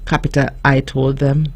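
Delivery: ordinary delivery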